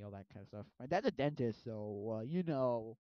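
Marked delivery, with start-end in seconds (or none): none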